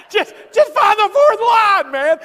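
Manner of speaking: high, loud, slowed shout through laughter; laughing mock shout. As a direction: bellow it while cracking up. Mocking the driving test instructions.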